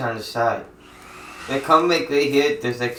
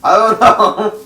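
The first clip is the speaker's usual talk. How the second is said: laughing; 8 semitones higher, 11 dB louder, slower than usual. Real, not acted. Laughing while answering.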